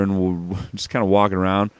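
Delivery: ordinary talk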